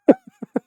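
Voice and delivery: loud high laugh; big laugh bursting out; burst of laughter. Real, not acted. Laughs at own story.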